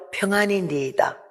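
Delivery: soft, hushed, composed reply; hushed serene reply; calm and quiet. Retold or imitated. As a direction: answer in a quiet calm voice though your heart breaks. Woman calmly answers despite dead child.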